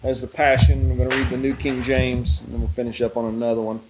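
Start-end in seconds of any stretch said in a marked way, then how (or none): none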